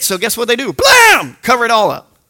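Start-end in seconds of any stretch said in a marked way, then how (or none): 0.7-1.4 sudden high shouted blam; sharp shouted sound effect; loud blam. Acted. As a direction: slam the sound out like a door. Covering it all up.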